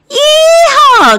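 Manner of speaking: loud high whoop of encouragement; cheering yell. Pretend. Narrator cheers Austin on, loud yell. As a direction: cheer him across the log.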